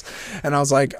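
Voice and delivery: ordinary talk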